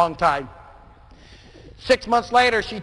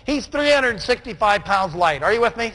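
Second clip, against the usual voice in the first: normal talking voice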